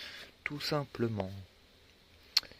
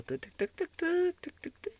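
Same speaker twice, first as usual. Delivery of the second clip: playful high tac tac; 4 dB louder, slower than usual. Real, not acted. Playful tac tac while browsing.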